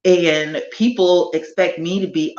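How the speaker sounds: usual speaking voice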